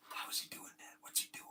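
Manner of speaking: hushed, wondering whisper; curious whisper. Retold or imitated. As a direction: whisper like spectators who can't look away. Men watching the mechanic, curious.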